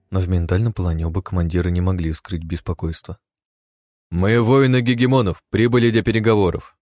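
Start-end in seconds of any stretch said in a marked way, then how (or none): 4.1-6.8 proud soldier voice. A narrator's reading. Soldier announces to undead lord.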